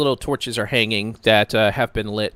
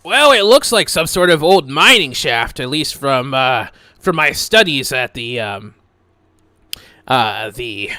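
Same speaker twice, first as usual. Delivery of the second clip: louder, higher know-it-all scholar voice; Alex, pompous know-it-all adventurer; 3 semitones higher, 8 dB louder than usual. Acted. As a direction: play him as a self-important scholar. GM plays Alex in character.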